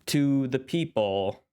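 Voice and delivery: usual speaking voice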